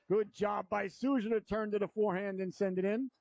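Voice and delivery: brisk live play-by-play; hockey announcer. Real, not acted. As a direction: call the game as it happens.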